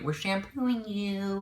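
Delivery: high, slow singsong teasing; mock sweet taunt. Friends pouring syrup on her head, high singsong taunt.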